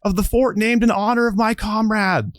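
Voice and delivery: high, furious outburst at the betrayal; outraged cry. Acted. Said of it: "she's like".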